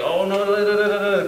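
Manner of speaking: high-pitched mock babble; imitated insistent chatter. Kuwaiti men who keep talking to him, imitated insistent chatter. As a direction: run on in nonsense syllables, mimicking foreign talk. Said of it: "they keep talking to me".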